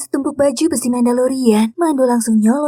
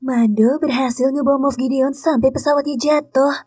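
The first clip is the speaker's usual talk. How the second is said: ordinary talk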